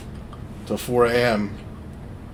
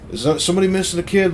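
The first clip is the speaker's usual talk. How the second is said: raised, fast call down a hallway; raised voice asking the hallway; 8 semitones higher, 4 dB louder, faster than usual. Retold or imitated. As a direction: call out to anyone who can hear. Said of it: "I yelled up the one hallway".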